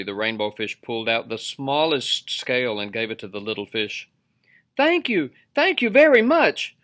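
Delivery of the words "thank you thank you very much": high, loud squeal of thanks; bubbling playfully. A narrator's reading. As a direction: gush thanks in a high little-fish voice. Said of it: "bubbled playfully".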